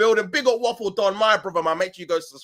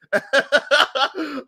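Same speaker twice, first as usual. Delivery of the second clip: high-pitched burst of laughter; helpless high giggle; real laugh; 9 semitones higher, 4 dB louder than usual. Real, not acted. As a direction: crack up at your own joke. Laughing at his own joke.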